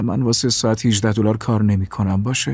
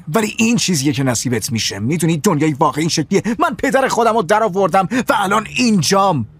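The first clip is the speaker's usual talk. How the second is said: raised, bitter outburst at his wife; angry rant; 7 semitones higher than usual. Acted. Husband lashes out at his wife about money, raised and bitter. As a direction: throw the blame at her, heated. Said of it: "ببخشید بابا داد زد".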